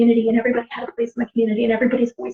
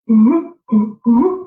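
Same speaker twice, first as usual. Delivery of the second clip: short hooted hum call; imitating auntie's call. Auntie calling out so family can find her, hummed hoots. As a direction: mimic an aunt's signal call. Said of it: "My Auntie Georgie".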